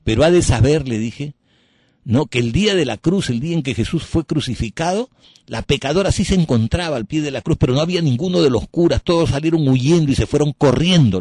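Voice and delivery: retold shout at the priest; shouted rebuke. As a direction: shout the truth back at him. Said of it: "le grité".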